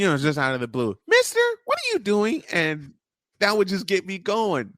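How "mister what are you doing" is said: pitched way up, playful imitation of the wife; high squeaky Jody voice. Host mimics his wife calling him mister. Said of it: "I adore Jody for".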